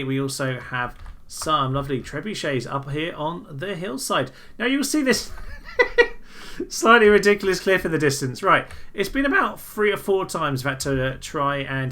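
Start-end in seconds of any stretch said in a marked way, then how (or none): 5.4-6.6 high laugh. Real, not acted. Laughs at ridiculous cliff.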